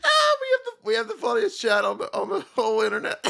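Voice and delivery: real tearful laughing; crying with laughter. Real, not acted. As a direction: squeeze words out mid-laugh. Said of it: "I'm crying".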